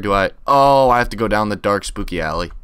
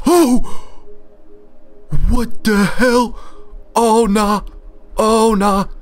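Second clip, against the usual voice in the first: terrified shout; 8 semitones higher, much slower than usual. Real, not acted. Gamer screaming as a monster suddenly appears, terrified shout. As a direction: yell in real panic at the monster.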